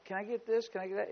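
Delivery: a hint of a pleading kid; little boy. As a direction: imitate a small boy asking his dad. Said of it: "the little boys are going".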